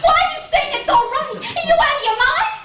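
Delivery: high, fast near-hysterical protest; overwrought outburst. Acted. Wife snapping at husband who says it's all right, high-pitched and frantic. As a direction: throw his comfort back at him, frantic.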